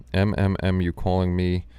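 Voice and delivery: ordinary talk, nothing marked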